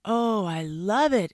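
slow, high, loud delight; exclaiming. A narrator's reading. Fox admiring his new tooth, delighted exclamation. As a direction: gush over it, drawn out. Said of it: "exclaimed the fox".

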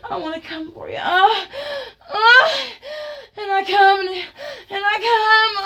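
loud pleading cries rising to a moan; impassioned cries. Acted. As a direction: act out mounting ecstasy, climbing to a wail. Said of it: "I gasp".

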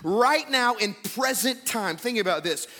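fervent pulpit declaration; impassioned preaching. Real, not acted. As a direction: build in pitch as you declare his majesty. Preaches Christ's exalted status.

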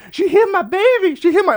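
loud, high-pitched imitation of a crying mother; tearful wail. Upset lady reporting the hit child, mock tearful wail. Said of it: "And she's crying".

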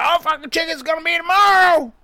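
high, loud gruff grill-man voice; high put-on Kenny voice. Friend retelling what the grill guy said, high put-on voice. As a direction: squeeze the voice up high like an old cook.

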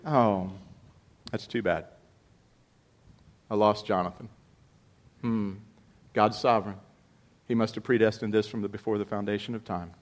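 quiet, offhand, mildly mocking indifference; mock calm shrug. Preacher mocks a detached David brushing off Jonathan's death, quiet and offhand. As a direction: shrug off the loss like it's nothing, lightly sarcastic. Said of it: "what did he do?".

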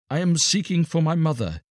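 lightly voiced high-pitched pleading boy; pleading beggar boy. A narrator's reading. Star child answers the guards at the gate, pleading. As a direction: plead gently, pitched well up.

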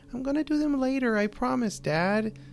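put-on high pleading child; whiny little girl. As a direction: plead like a pouting kid.

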